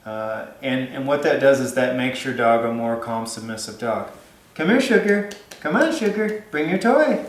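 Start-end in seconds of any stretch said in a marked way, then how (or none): 4.6-7.2 much higher, sing-song call to the dog. Real, not acted. Trainer calling her dog Sugar to bring her toy, high coaxing call. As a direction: coax the dog over, pitched way up.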